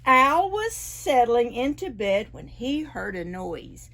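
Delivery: usual speaking voice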